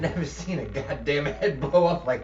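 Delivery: put-on a touch of New Jersey accent. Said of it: "In this thick New Jersey accent".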